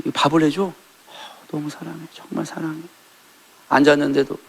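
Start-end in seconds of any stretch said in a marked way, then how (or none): 0.6-2.8 softly murmured love, much quieter; lightly voiced doting husband, hushed. Husband whispers love again.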